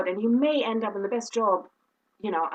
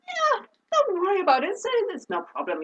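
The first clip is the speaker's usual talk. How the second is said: tag; 8 semitones higher than usual. Retold or imitated. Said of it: "Out loud, I said".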